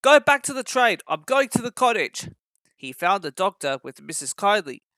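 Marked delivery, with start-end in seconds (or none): 0.0-2.3 higher, louder urgent command; urgent order. A narrator's reading. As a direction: send them back quickly. Driver gives urgent orders.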